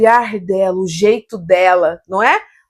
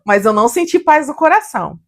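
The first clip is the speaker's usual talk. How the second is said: ordinary talk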